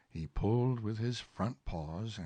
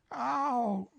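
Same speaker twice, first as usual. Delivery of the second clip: high, sharp bear yelp; high mock cry; 4 dB louder than usual. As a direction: yelp as if pulled. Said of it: "cried Pooh".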